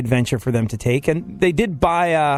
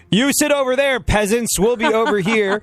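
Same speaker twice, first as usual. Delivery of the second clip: snooty; 9 semitones higher than usual. Retold or imitated. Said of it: "were they all like".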